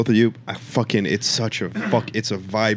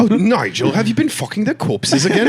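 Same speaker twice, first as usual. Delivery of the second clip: high, indignant British sitcom voice; British mortician; 8 semitones higher than usual. Acted. Riffing as British morticians to Nigel, high mock-scolding British accent. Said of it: "Oh, British, uh, morticians".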